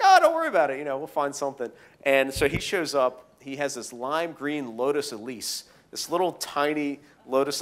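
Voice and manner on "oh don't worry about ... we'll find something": breezy reassurance. Retold or imitated. Friend shrugging off rally car worries, breezy. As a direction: casually wave the worry away. Friend brushing off worry in retold dialogue.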